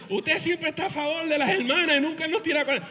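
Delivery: mock complaint of the church brothers; brothers' complaint. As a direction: whine it like the men in the pews. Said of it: "los hermanos a veces dicen".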